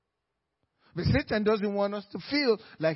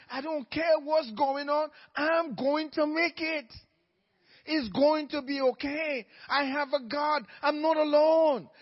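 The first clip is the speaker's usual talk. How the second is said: emotional confession; 5 semitones higher, 4 dB louder than usual. Pretend. Voicing the believer's confession.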